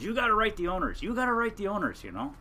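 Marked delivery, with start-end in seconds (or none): 0.0-1.0 higher-pitched urging friend; quoting a friend. Retold or imitated. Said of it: "And he, he goes". 1.0-2.0 the same urging repeated, still higher; quoting a friend. Retold or imitated. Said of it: "And he, he goes".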